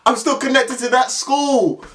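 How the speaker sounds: high, slow solemn warning; mock final threat. Caretaker's last word to the boys, high slow pompous warning. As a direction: deliver the punchline as a pompous threat.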